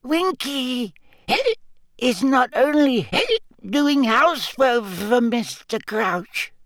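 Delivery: very high, hiccup-broken elf protest; indignant squeak. A narrator's reading. Winky squeaks indignantly at Hermione between hiccups. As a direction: huff like an offended drunk elf. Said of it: "Winky squeaked indignantly".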